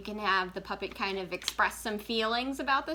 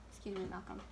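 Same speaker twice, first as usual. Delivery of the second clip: "Excuse me, Malcolm" said again much softer; softer second ask to the cat; quieter repeat; 10 dB quieter, faster than usual. Real, not acted. Host asking the cat Malcolm to move again, much softer.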